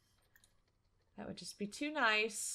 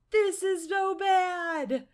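high, loud, slow cry; drawn-out high shout of dismay; panicked wail; 9 semitones higher, 8 dB louder, much slower than usual. Real, not acted. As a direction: wail it in alarm. Panics at the crowd of gnomes.

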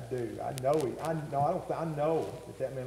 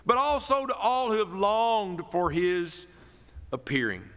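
preaching; 8 semitones higher, 4 dB louder than usual. Acted. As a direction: build the verse to a high, loud climax. Preaches scripture.

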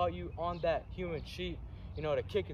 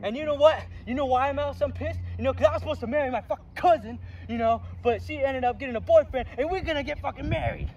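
louder, higher furious ranting; angry rant; 8 semitones higher, 9 dB louder than usual. Acted. Coach raging at the kid about his cousin.

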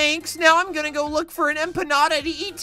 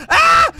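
very high, loud scream; piercing game-panic "ah"; playful scream; 8 dB louder than usual. Pretend. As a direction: shriek in mock panic. Chased by villain in game.

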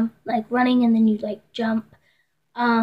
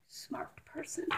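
whisper; 3 semitones higher, 25 dB quieter, slower than usual. Real, not acted. Whispered self-mocking aside.

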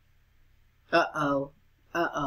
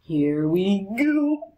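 ordinary talk

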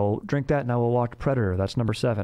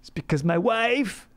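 put-on high-pitched self-pitying drunk; hammed-up forlorn husband, higher voice; mock sad; 7 semitones higher than usual. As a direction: confess like a sad drinking buddy. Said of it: "then it's gonna get sad".

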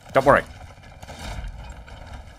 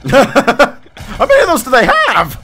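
high, loud and slow through laughter; laughing; 11 semitones higher, 6 dB louder, slower than usual. Real, not acted. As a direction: laugh out the question in disbelief. Laughing at enemy fire.

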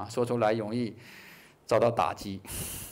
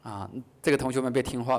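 normal talking voice

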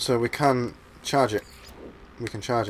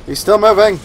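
high, loud startled cry; startled shout; 9 semitones higher, 11 dB louder than usual. Real, not acted. Gamer shouting as the creature still moves. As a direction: yelp it out in shock.

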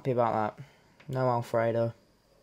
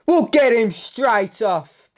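loud vow; 7 semitones higher, 8 dB louder, slower than usual. Real, not acted. Vowing to sell a player.

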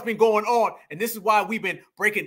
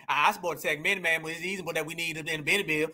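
low, hurried, softer mock mumble; mocking mimicry; 6 semitones lower, 7 dB quieter, much faster than usual. Commentator mimicking the woman in the clip, mocking mumble. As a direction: mumble it low and fast, making fun.

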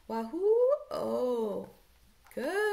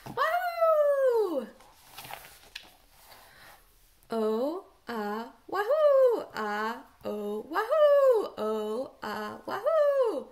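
excited wahoo; 4 dB louder, much slower than usual. Shown as a demonstration. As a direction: whoop it out with glee. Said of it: "Do you see the pattern".

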